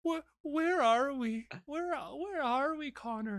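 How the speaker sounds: high, faint, trembling dying wife; frail dying voice. Retold or imitated. The dying wife asks her husband, faint and high. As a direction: fade out, lost and frail, mock tearful. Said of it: "and then she goes".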